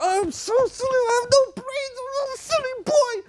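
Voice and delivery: very high, squeaky comic wail; mock beating oneself up. Teacher modeling the wrong reaction so children laugh, high mock wail. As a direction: wail in exaggerated self-reproach. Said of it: "Should I start beating myself up?".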